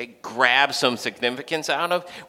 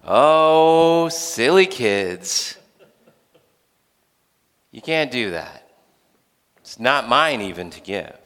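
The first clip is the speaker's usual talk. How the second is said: indulgent refusal; 2 semitones lower, slower than usual. Acted. Jesus turning down James and John, indulgent refusal. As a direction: let them down like an indulgent parent.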